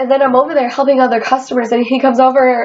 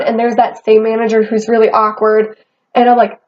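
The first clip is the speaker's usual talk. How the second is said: normal talking voice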